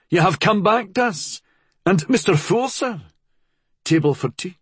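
high-pitched Scottish innkeeper; lilting Scottish host greeting; thick Scottish accent. A narrator's reading. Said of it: "the man said in a thick Scottish accent".

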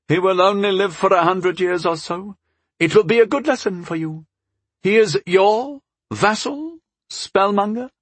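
high-pitched lordly voice; dismissive elven lord. Acted. Father belittles the marriage to daughter, high and dismissive. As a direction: brush it off in lofty, high tones.

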